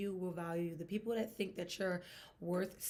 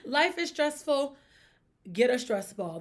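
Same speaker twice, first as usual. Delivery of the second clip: emphatic; 6 semitones higher, 8 dB louder than usual. Real, not acted. Emphatic advice after laugh.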